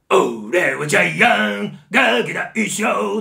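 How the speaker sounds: loud, slow, shouted bully singing; harsh, drawn-out bully bellow; Gian's bellowing song. Shown as a demonstration. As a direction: belt it like a pushy bully yelling at everyone. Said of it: "うわーって怒鳴るような歌い方".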